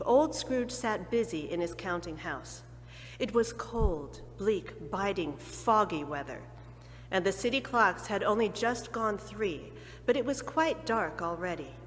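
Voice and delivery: plain reading voice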